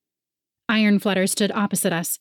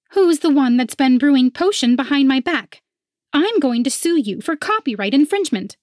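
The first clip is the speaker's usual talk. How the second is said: indignant rival voice; 7 semitones higher, 4 dB louder than usual. A narrator's reading. Rival accuses narrator.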